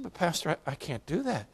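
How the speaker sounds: put-on soft, barely-there old lady's refusal; hushed protest. Widow meekly refusing her pastor, very softly. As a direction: murmur it, nearly breathless.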